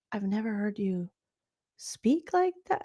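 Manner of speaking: hushed whisper. Retold or imitated. Host whispering her reaction to Morgan's new talk. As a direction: breathe it as a shocked secret.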